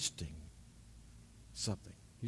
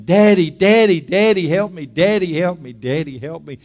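put-on child's cry for help; 5 semitones higher, 11 dB louder than usual. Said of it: "this kid is screaming".

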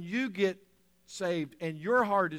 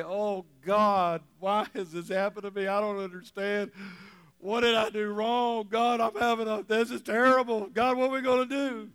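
whining, panicked cry to God, pitched higher; mock despairing prayer; 3 semitones higher, 4 dB louder than usual. Preacher mocking what Paul and Silas did not say, panicky wail. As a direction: ham up a prisoner wailing at God. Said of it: "No, it didn't say that".

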